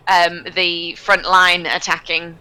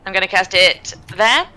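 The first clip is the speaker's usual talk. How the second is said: ordinary delivery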